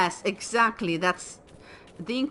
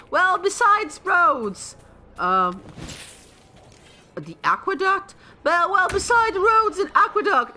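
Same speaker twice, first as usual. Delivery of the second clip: Python rebel quote; 10 semitones higher, 6 dB louder than usual. Retold or imitated. Streamer still quoting the Life of Brian scene, high and exasperated. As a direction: grudgingly list what the Romans did, hammed up. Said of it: "when they say".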